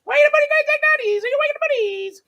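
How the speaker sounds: put-on very high, loud squealing baby talk; high squeaky pet voice calling the dogs awake. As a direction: coo to wake the dogs.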